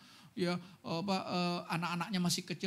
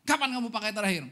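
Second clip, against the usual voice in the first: loud, sharp interrogator's demand; a loud, stern policeman asking the boyfriend when he last used; stern cop question; 4 semitones higher, 7 dB louder, faster than usual. Retold or imitated. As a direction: bark it like a police officer grilling a suspect.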